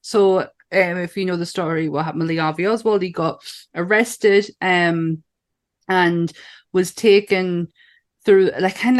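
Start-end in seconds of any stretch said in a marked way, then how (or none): none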